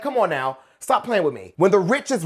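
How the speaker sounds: normal talking voice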